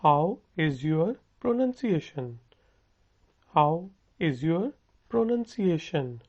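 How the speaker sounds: very slow, each word spelled out for learners; slow it right down so students can copy; slow model sentence. Shown as a demonstration. Teacher slowly modelling a question for students to repeat. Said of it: "आपका तलफ़ुज़ कैसा है?".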